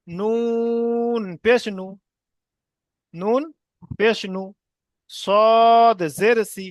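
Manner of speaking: slow drill with long-held letter names; deliberate, chant-like spelling aloud; spelling piece by piece. Shown as a demonstration. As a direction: stretch each letter, pause, then blend the syllables. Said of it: "কেমন ভাবে বানান করবো".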